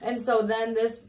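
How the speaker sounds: usual speaking voice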